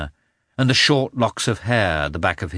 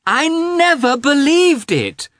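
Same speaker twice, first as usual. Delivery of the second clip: very high, loud, slow protest; little boy declaring it with full force; emphatic child; 9 dB louder, slower than usual. A narrator's reading. Boy denies believing mother dead.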